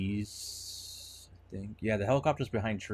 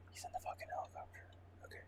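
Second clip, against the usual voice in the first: fast gasping whisper; excited whisper; 19 dB quieter, much faster than usual. Real, not acted. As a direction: rush it out in a thrilled whisper. Gasp then excited hush.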